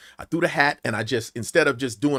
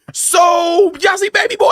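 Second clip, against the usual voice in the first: calling out; 8 dB louder, slower than usual. Retold or imitated. Addresses the silent crowd on stage.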